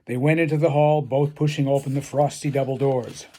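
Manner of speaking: usual speaking voice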